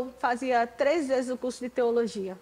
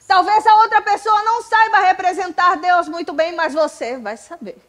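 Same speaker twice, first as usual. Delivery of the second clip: preaching at full force; 6 semitones higher, 12 dB louder than usual. Real, not acted. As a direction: proclaim it to the whole church. Preacher climax again.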